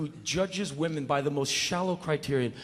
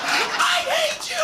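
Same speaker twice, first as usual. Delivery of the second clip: high, slow shrieking wife; falsetto scream; slower than usual. Retold or imitated. Wife shrieking at husband, high and slow.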